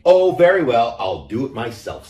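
loud, high sigh of giving in; exasperated hen. A narrator's reading. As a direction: give up with a huff. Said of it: "said the little red data hen".

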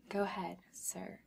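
normal talking voice

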